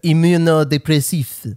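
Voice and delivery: put-on lightly French-accented word. Friend imitates the French nurse's word, French accent. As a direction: say Immunodepressif with a French accent, stress on the last syllable. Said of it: "with a French accent".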